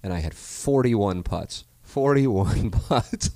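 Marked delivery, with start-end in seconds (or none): 1.8-3.3 slow, high, laughing repeat; laughing disbelief. Real, not acted. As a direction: stretch it out, cracking up.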